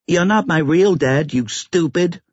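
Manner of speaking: put-on high-pitched little-boy outburst; small child's defiant voice; angry child. A narrator's reading. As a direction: lash out like an upset five-year-old. Five-year-old boy quarrelling with father.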